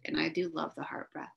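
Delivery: ordinary talk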